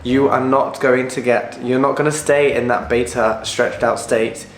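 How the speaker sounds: ordinary delivery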